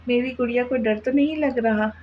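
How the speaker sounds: usual speaking voice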